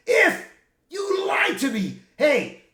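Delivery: high, loud, slowed yell of betrayal; retold angry shout. Wolverine shouting at Deadpool for lying, furious yell. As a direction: bellow it like you've just been lied to.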